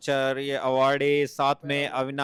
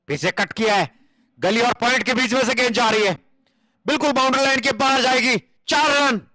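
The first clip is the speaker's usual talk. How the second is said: high, excited boundary call; rising call to the rope; excited cricket commentary; 8 semitones higher, 4 dB louder than usual. Acted.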